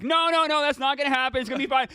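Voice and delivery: soothing a would-be puncher; mock reassuring. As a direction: talk the guy down in a rush. Calming a teammate's threat.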